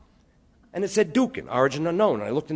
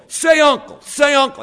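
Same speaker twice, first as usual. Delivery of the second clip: loud, high taunting shout; bullying big brother; 12 semitones higher, 9 dB louder than usual. Retold or imitated. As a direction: yell it down at a pinned little brother. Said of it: "you hold your six-year-old brother in the neck hold and you go".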